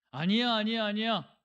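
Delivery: high, loud repeated protest; insistent denial. Acted. Someone refusing to confess before God, insistent denial.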